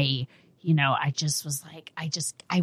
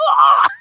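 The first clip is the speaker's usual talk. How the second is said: high loud shocked cry; startled yelp; 10 dB louder than usual. Retold or imitated. Mother feeling sudden pressure on her belly, yelping.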